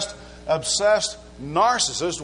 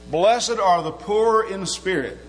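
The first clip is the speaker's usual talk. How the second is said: 1 scripture reading; 4 semitones higher, slower than usual. Acted. Reads scripture in sermon.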